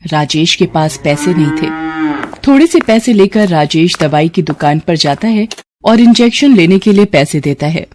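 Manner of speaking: plain reading voice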